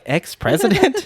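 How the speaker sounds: high, slow disbelieving ex-president; incredulous question. Retold or imitated. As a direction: stretch it out in mock disbelief. Incredulous mock of the phrase.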